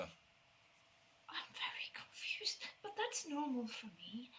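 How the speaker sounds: put-on soft whisper, slow, lost child; confused girl. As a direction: murmur it to yourself, dazed. GM voices the girl.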